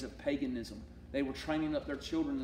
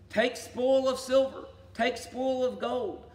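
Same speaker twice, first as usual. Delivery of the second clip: forceful scripture reading; 8 semitones higher, 4 dB louder, slower than usual. Acted. Preacher reads prophecy aloud.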